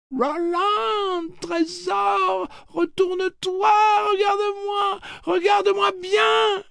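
very high, loud, joyful calling; high, crazy with joy. Acted. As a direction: squeal his name with mad joy. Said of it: "une voix aiguë et folle de joie".

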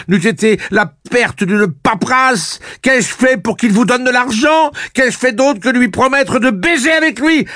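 very high, loud unstoppable tirade; furious high-pitched rant; overflowing fury. A narrator's reading. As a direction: pour out every grievance at a yell. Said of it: "saisie d'une fureur débordante".

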